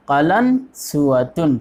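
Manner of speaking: قلنسوۃن very slowly, in separated parts; قلنسوۃن stretched out piece by piece; slow word breakdown. Shown as a demonstration. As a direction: draw out قلنسوۃن for learners to copy. Teacher breaks down new word.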